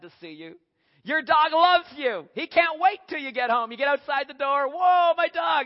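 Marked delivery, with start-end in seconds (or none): 1.0-4.7 high, loud burst of doggy joy; overjoyed. Acted. Describing a dog's overjoyed greeting. 4.7-5.7 high, loud excited whoop; delighted greeting. Acted. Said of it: "and you go".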